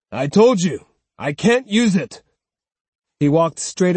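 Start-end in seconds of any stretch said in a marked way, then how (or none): 0.1-2.2 louder, insistent refusal; firm refusal. Acted. Swordsman repeating his refusal, louder and insistent. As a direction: repeat it firmly.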